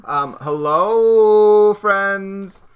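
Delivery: loud, drawn-out bright hello; energetic greeting. Pretend. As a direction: greet them bursting with energy. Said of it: "Look how full of energy we are".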